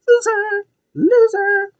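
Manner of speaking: very high squeaky mock taunt; drawn-out falsetto jeer. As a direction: tease in a tiny falsetto voice. Sing-song high taunting repeat.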